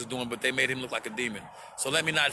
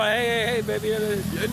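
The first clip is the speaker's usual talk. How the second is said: mock hey baby call; 6 semitones higher, 4 dB louder than usual. Mocking people calling him.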